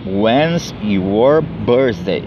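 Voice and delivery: very slow, "When's your birthday?" stretched syllable by syllable; slow model question. Shown as a demonstration. Teacher slowly repeating the question so students can copy it. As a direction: drag each word out for the learner. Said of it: "como que se pergunta o aniversário".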